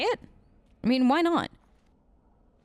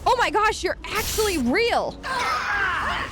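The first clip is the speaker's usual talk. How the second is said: excited shout; 8 semitones higher, slower than usual. Real, not acted. Player amazed the summoned spirit actually appeared, excited shout. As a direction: yelp with delight that it worked.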